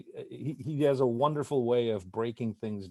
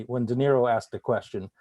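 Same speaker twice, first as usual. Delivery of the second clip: ordinary talk, nothing marked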